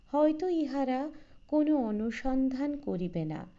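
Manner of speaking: ordinary delivery, nothing marked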